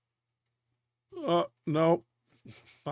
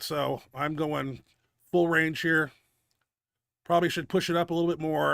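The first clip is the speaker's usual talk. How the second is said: normal talking voice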